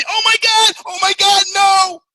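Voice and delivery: high, loud mock panic on the ride; mock screaming. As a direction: scream it like you're plunging down the drop. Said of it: "You're screaming".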